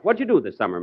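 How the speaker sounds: usual speaking voice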